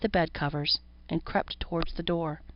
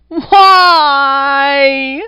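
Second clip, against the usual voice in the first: high, loud, crying falsetto shout; 8 semitones higher, 14 dB louder than usual. A narrator's reading. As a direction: wail it out in a tinny high falsetto. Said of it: "The strange falsetto had a tinny vibration".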